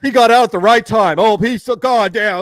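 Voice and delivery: hammed-up fan praising Jeff; mock fan gushing. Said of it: "sheep will be right there being like".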